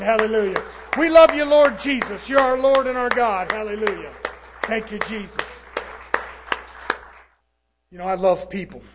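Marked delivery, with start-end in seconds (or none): 0.0-5.6 high-pitched worship, fading to hushed thanks; rising worship that falls to a hush; praise prayer. Acted. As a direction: lift it up to the Lord, then settle. Leads a short praise prayer.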